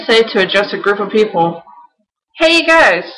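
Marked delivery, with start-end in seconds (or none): 2.4-3.0 excited call. Shown as a demonstration. Answering how to address a group.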